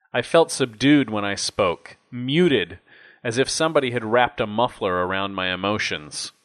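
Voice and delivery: ordinary talk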